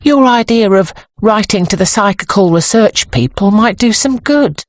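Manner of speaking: high-pitched, tentative suggestion; hesitant and high, unsure of the reception; uncertain. A narrator's reading. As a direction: float the idea doubtfully, voice lifted. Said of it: "she said uncertainly".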